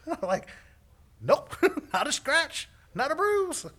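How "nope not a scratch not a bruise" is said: high, loud, breezy denial; hammed-up bright reassurance; chipper. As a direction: cheerfully brush off the worry. Chipper reply to alarmed father.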